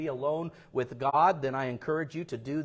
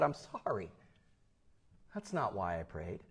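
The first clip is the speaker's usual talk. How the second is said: soft, low apology; subdued, firm minister's reply; calm refusal; 8 semitones lower, 11 dB quieter than usual. Retold or imitated. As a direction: answer quietly, dropping low at the end. Minister calmly refuses again and leaves.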